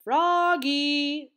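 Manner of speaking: long, high, loud call of a name. A narrator's reading. Teacher calling Froggy in class, loud call. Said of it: "called his teacher".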